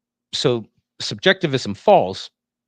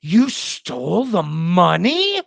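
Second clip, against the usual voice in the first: drawn-out, higher, louder accusation ending on a rise; emotive accusing tone; 6 semitones higher, 6 dB louder, much slower than usual. Shown as a demonstration. Lecturer demonstrating tone against bare fact, emotive accusing tone. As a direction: stretch every word into a loaded, rising accusation. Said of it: "When I say it in the tone".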